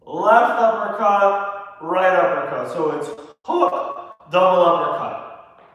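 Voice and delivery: loud, punchy coach calls, one per punch; calling out combos. Acted. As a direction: bark each punch name like a boxing coach.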